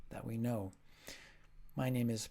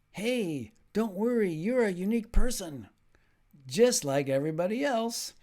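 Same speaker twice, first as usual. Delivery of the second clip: higher, louder cheery reassurance; joke delivery; 8 semitones higher, 7 dB louder than usual. Shown as a demonstration. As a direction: deliver it like a bright comforting pep talk. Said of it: "So here's a joke".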